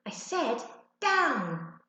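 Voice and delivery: very slow, firm and loud. A narrator's reading. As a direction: lay down the law to the dog. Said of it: "spoke more firmly".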